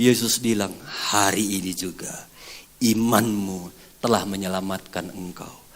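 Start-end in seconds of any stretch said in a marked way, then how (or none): 1.1-5.6 soft Jesus. Acted. Jesus pronounces healing softly.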